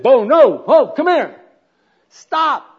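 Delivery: loud, high, panicked shouting at a runaway dog; frantic yell. Retold or imitated. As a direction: yell the dog back before the car hits him. Panicked owner yelling at dog.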